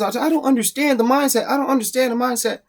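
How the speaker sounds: high mock complaint of baffled people; baffled objectors. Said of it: "everyone's like".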